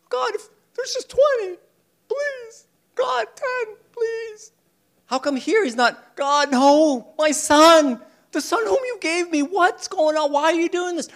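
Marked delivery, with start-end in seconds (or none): 0.1-4.5 high, far pleading prayer with a catch; pleading Abraham. Acted. Abraham begging God to spare Sodom, high and pleading. 6.2-11.1 louder, higher cry of protest; anguished protest. Acted. Abraham crying out to God for his son, loud and high.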